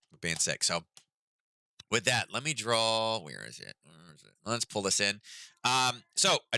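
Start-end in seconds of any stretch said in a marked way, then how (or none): none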